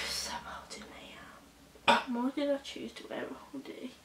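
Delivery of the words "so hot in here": breathy, slow whisper after a sigh; sigh, then whisper it slowly, wilting in the heat; hot and whispering. Real, not acted. Vlogger too hot in her hoodie, slow sighing whisper. Overheated under a hoodie, complaining.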